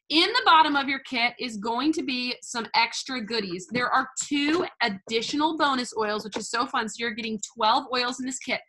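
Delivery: ordinary talk, nothing marked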